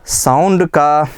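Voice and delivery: Sound said slow and weighty; slow stressed word. Shown as a demonstration. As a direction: drag out sound slowly and loudly. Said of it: "sound का मात्रा क्या हुआ".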